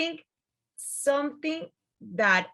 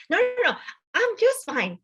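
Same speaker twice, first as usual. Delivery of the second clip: high, loud carefree refusal; breezy refusal; 12 semitones higher, 6 dB louder than usual. Retold or imitated. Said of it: "And he's like".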